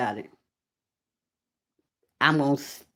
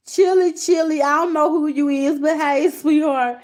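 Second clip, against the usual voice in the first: laughing greeting; 9 semitones higher, 4 dB louder than usual. Real, not acted.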